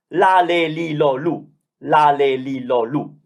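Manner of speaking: L with clean open vowels, twice over; Italian syllables. Shown as a demonstration. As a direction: model the L row clearly. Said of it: "the L sound".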